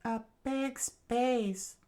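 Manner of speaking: slow, very high falsetto; drawn-out falsetto thinking aloud; princess musing to herself. Acted. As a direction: muse slowly in a high princess voice. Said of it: "said to herself".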